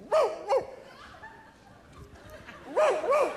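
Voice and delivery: high imitated dog bark; Lassie. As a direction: yelp twice like an alert dog. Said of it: "A dog like Lassie who would go".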